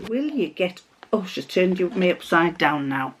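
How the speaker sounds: usual speaking voice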